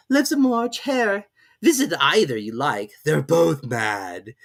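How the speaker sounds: slow, drawling cat voice; Cheshire Cat. A narrator's reading. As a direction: toy with the girl lazily.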